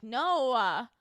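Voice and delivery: loud "Noah", no stretched into two syllables; dragged-out no. Retold or imitated. Kid telling mom no, loudly dragged "Noah". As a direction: drag a no out until it sounds like "Noah". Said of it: "make fun of me because".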